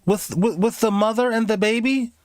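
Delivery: high sweet nurse voice, mocking; syrupy mock nurse. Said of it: "As soon as".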